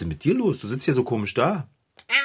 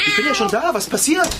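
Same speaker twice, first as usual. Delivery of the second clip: much higher, hurried alarm; alarmed rush; 13 semitones higher, faster than usual. Acted. Figarino eilt zum schreienden Kater zurück, aufgeregt. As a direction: come running, worried. Rushes back to cat.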